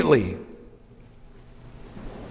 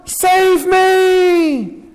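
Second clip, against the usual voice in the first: much higher desperate shout; crying out; 13 semitones higher, much slower than usual. Acted. Sinking Peter crying out to Jesus, high desperate shout. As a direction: yell for rescue while sinking. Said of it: "he cried out".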